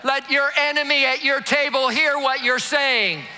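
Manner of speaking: shouted high preaching, driving the crowd; preaching at full force. Acted. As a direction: preach it out loud to the whole room.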